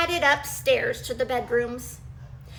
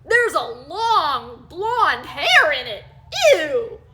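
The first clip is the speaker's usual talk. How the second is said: disgusted; 2 semitones higher, 8 dB louder, slower than usual. Acted. Daddy Bear disgusted.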